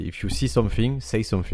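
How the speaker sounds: English phrase in a thick French accent; strong French accent on English words. Real, not acted. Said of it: "t'as parlé avec cet accent".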